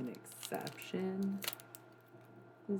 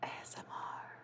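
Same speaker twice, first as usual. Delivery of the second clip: ASMR whisper. Shown as a demonstration. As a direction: breathe the letters into the mic. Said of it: "That's my favorite one to do".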